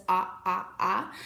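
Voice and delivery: "ah" said as an open short o, repeated; short O sound. Shown as a demonstration. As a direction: sound out "ah" like the o in octopus. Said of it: "the sound that it makes is ah".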